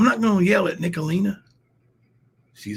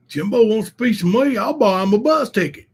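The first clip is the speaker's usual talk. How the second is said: louder, higher taunting rival voice; mocking rival; 6 semitones higher, 6 dB louder than usual. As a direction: throw the taunt like the smug rival.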